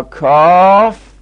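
کاف with a long, loud, rising vowel; prolonged recitation. Shown as a demonstration. Teacher giving a Quran letter example, prolonged recitation.

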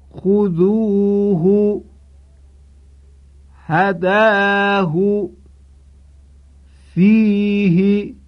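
خذوه هداه فیه with short ha, very slow chant; Qur'anic recitation. Shown as a demonstration. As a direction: chant each word slowly, no elongation. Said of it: "توجه کنید". (تلاوت آرام بدون اشباع های ضمیر)

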